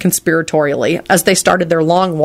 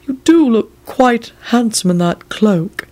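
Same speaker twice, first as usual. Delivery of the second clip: slow, higher-pitched admiring servant; appreciative; 5 semitones higher, slower than usual. A narrator's reading. Servant admiring the guest's cloak, appreciatively. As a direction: linger over the compliment in character. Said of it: "he said appreciatively".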